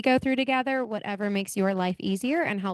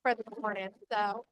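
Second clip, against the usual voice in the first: muffled, mumbled bad phone line; underwater sound; 8 dB quieter than usual. Real, not acted. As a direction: voice garbled like underwater. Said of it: "it sounds like you're underwater".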